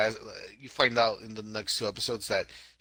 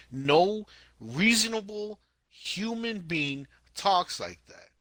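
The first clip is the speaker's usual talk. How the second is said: high, slow, word-by-word insistence; emphatic exasperation; 8 semitones higher, slower than usual. Real, not acted. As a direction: drive each word home, exasperated. Ranting at the show's dialogue.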